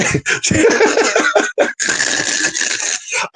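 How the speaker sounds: long high laugh. Real, not acted. Telling a story about grandma, breaks up laughing.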